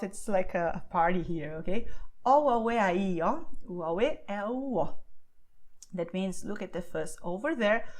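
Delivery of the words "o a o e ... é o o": O, a, o, e, a, i, o said as clean separate vowels; vowel-only phrase. Shown as a demonstration. Teacher sounding out the vowel phrase for learners. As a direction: sound out each vowel, mouth wide open. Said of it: "I open and close my mouth".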